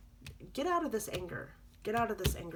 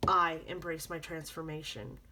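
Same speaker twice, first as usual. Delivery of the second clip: ordinary talk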